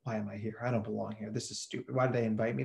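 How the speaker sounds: quiet, low self-doubting mutter under the breath; muttering to himself. Retold or imitated. Stranger at the keynote muttering doubts to himself, quietly. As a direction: mumble it to yourself, barely audible. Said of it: "someone next to me muttering to himself".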